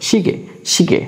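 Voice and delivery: "শিগে" ending in a simple ge, no ye; final vowel only. Shown as a demonstration. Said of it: "শেষের অংশ আমরা উচ্চারণ করব".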